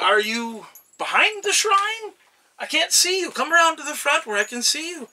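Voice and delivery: excited searching god. Acted. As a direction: peer around eagerly for a hidden friend.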